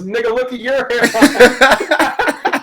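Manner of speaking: high, loud, drawn-out mock retort; mock outraged comeback. LeBron's comeback in a meme, hammed-up loud retort. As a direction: throw it back much bigger, stretching it out.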